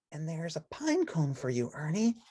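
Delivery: high, hushed, gentle bird voice; Big Bird voice. Acted. Big Bird showing the nest, hushed and high. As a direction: softly point it out as Big Bird.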